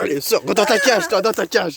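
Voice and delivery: loud, barking order; shouting guard. Acted. Guard shouting at the prisoner to get in the cage. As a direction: bark him back into the cage.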